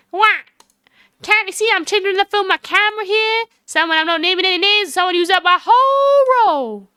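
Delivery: high, irritated Southern drawl. Acted. Photographer grumbles at whoever used up her film, Southern drawl. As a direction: complain pointedly in a high Southern twang. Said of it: "she sounds like that now".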